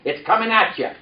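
calling out the line for echo; preacher's call line; congregation repeat-after-me. Shown as a demonstration.

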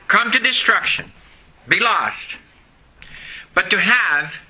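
ordinary talk, nothing marked

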